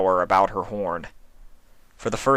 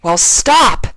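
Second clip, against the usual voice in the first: much higher, loud shout; angry shout; 13 semitones higher, 11 dB louder than usual. A narrator's reading. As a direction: yell the order. Twilight orders.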